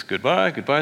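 lilting mock-cheerful farewell; sing-song goodbye. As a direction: chirp goodbye like a cheerful dog. Dogs part in story.

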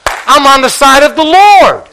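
high, loud, proclaimed from the pulpit; ringing declaration. Acted. Preacher answering his own question, loud and proud. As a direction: shout it out as a testimony.

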